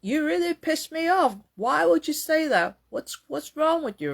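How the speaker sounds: high, accusing retort in imitation; re-enacted angry outburst. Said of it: "because the way I said that".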